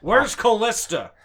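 bellowing for his wife; Harrison Ford impression. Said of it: "you have to do Harrison Ford loud".

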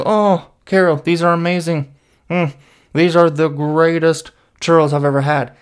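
high, loud, gushing praise of a nice coworker; Gary gushing. Retold or imitated. Gare Bear praising Carol's churros, high and gushing. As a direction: gush over the churros way up high. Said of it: "He's like".

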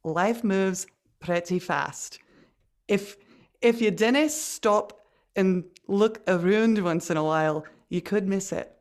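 slow, careful Scottish accent; sit back into an easy, unhurried Scottish accent. Shown as a demonstration. Host has another go after coach says relax, slow Scottish accent. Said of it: "let's have another go".

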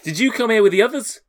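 high-pitched suspicious question; shouting door guard. Acted. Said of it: "a shouting voice".

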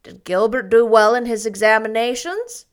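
a touch of a dogged aunt; persistent question. Acted. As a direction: press the point firmly. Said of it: "persisted Marilla".

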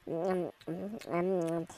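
munching and humming with pleasure; eating trash. Acted. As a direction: savour it with mm sounds. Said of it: "no comas eso".

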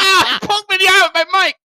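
high, loud laughing yelp; startled outburst. Real, not acted. Hit by own mic while laughing, startled yell.

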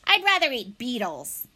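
lightly voiced badger grumble; a hint of a grumpy badger brushing it off; grumbling badger. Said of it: "he grumbled to himself".

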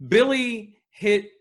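slow, loud, high emphasis on Billy hit; dramatic slow buildup. Acted. Hosts hyping a wrestling slap, slow dramatic emphasis. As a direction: drag out the setup for the punchline.

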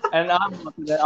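ordinary delivery

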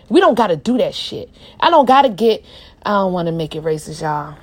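usual speaking voice

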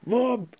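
high squeaky cartoon boing; boing sound effect. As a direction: squeal a cartoon bounce noise. Sound effect of face plant.

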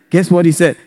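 ordinary talk, nothing marked

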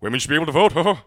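hammed-up jolly Robin Williams riff; loud, bouncy, over-the-top feminist. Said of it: "Don't do a Robin Williams version of a feminist".

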